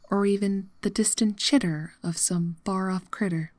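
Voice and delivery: plain reading voice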